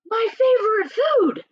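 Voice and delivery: high-pitched storybook monster voice; delighted Gruffalo. Acted. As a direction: pipe up greedily at the sight of food. Gruffalo spots the mouse.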